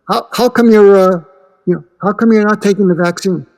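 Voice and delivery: pointed, disbelieving question; incredulous challenge. Acted. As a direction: demand an answer, exasperated. Acted pro-vaxxer questioning anti-vaxxer.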